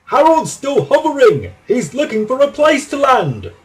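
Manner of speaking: high, loud, gleeful report; excited shout. A narrator's reading. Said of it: "he shouted".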